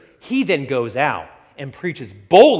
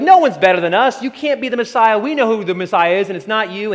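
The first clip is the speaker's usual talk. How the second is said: louder, higher haughty dismissal; prideful Pharisees; 7 semitones higher, 6 dB louder than usual. Retold or imitated. Proud Pharisees rejecting Jesus as Messiah, haughty and raised. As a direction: look down on him as you say it. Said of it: "They're like".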